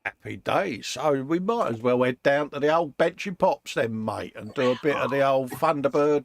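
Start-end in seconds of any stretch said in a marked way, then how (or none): none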